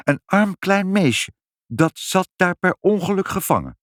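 high, earnest mother; high-pitched appeal for sympathy; pleading for pity. A narrator's reading.

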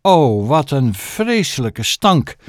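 slow, lingering oh of disgust; drawn-out disgust. Acted. Narrator shows listeners how stank looks, slowly. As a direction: let the stank hang in the air, slowly. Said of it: "Hij ziet er zo uit".

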